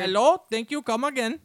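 fake Indian accent. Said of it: "fake the Indian accent".

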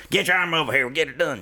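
lightly put-on country voice, pitched a bit high; a hint of a good-old-boy challenge; Larry the Cable Guy impression. Comic telling friends the story, light country impersonation. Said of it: "That's my Larry the Cable Guy impression".

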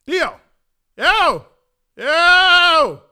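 high, loud yells, building in force; shouted calling out. Retold or imitated. Passenger re-enacting calls to his unresponsive friend, escalating shout. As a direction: yell to rouse someone, each call bigger.

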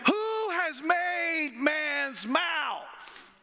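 slow, high booming shout; frustrated God. Retold or imitated. God rebuking doubting Moses, slow high shout. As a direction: thunder the question out slowly. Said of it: "he did say this".

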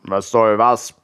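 a saucy stomach answering back; cheeky stomach voice. A narrator's reading. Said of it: "antwortete Willis Magen frech".

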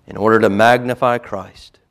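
forceful loud proclamation; loud preaching. Real, not acted. Preacher rising to full force.

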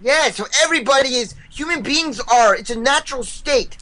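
put-on high, fast kid voice; mock kid voice. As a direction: rattle it off like a smug kid. Kid answering as grown-up.

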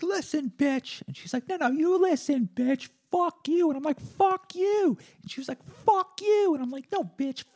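very high-pitched, strained mock yelling; hammed-up high-pitched slanging match; acted screaming row. Acted shouting match with the nurse.

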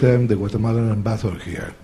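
usual speaking voice

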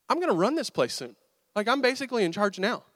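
hammed-up swagger of a newly proud kid; cocky boasting. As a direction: boast as if you own the place. Boy's cocky thoughts after success.

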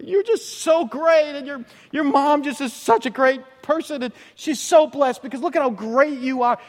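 awestruck woman. Retold or imitated. A woman in the crowd revering Jesus, high swooning. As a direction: swoon over him like a fan. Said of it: "She's revering him".

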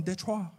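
"Detroit" said softly as French "deh-TRWAH"; soft, Frenchified "Detroit", "deh-TRWAH"; French pronunciation. Acted. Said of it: "imagine me going around going".

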